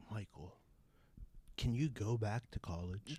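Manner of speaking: soft, low imitation of a tearful aunt; verge of tears. Aunt pleading with her nephew, quietly near tears. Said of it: "like, the verge of tears".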